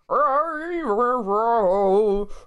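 loud, high wordless singing. Shown as a demonstration. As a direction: belt out sung gibberish like a grunge frontman. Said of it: "every word out of your mouth was just gibberish".

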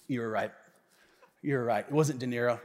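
low, soft mock confession; sheepish admission. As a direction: imitate a friend quietly conceding defeat. Friend sheepishly admits his mistake.

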